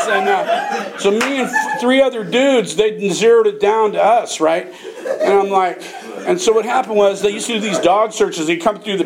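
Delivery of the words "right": ordinary delivery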